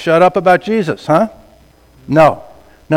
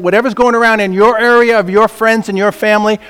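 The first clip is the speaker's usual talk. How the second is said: ordinary talk, nothing marked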